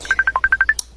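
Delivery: very high squealing giggle; high squeal laugh. Real, not acted. Friend squealing after a cheeky goodbye on video call. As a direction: squeal with delight.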